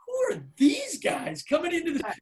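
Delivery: huffy snobs looking down on them; indignant diners. Retold or imitated. Diners glaring at the rock stars, mock outraged.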